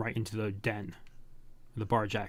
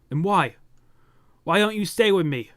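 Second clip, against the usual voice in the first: higher, louder desperate plea; pleading cry; 5 semitones higher, 4 dB louder than usual. Acted. Companion begs her hero to stay, pleading higher and louder. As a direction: beg him not to leave. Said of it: "He's crying".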